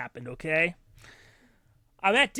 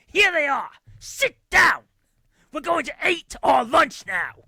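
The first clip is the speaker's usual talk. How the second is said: high, loud furious yelling; dad yelling at family over lunch; angry shout; 9 semitones higher, 6 dB louder than usual. Retold or imitated. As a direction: slam the words down like an upset dad. Angry dad throws napkins.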